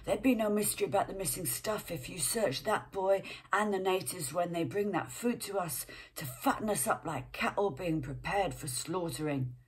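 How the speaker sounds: put-on rough London accent, said with a sneer. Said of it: "Sad Sally's a bit London".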